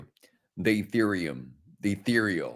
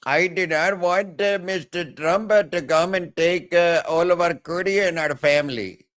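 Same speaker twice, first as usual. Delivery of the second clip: put-on Indian accent; 6 semitones higher than usual. Immigrant worried about curry and family, mock Indian accent. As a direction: lament in a hammed-up Indian accent. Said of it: "Yeah, the accents".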